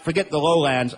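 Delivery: ordinary talk, nothing marked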